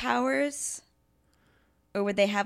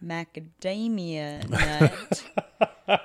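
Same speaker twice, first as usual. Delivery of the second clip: very slow; 3 semitones lower, much slower than usual. Shown as a demonstration. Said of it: "if I talk very slowly".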